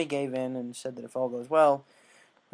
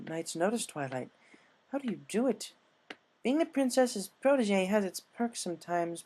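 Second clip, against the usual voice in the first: higher pony voices chatting; cheerful curious friends, higher voiced; higher pony voices on the way to court; lightly voiced unicorns; 7 semitones higher than usual.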